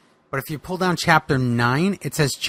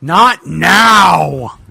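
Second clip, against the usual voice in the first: sharp retort; 9 dB louder than usual. Acted. Boogeyman snaps at Hacksaw's ghost.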